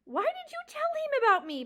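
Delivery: loud, high shout. A narrator's reading. Mouse shouting at the porcupine, loud high accusation. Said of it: "Poppy shouted".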